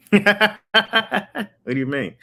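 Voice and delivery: laughed through, slow and high; laughing. Real, not acted. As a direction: laugh the words out slowly. Laughing at the sex question.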